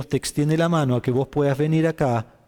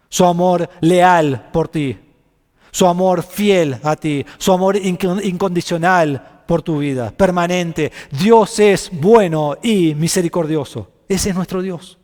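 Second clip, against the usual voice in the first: slow, loud, weighty preaching; preacher slowly declaring God's faithful love; solemn proclamation; 3 semitones higher, 6 dB louder, slower than usual. Acted. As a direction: lay each phrase down like a promise.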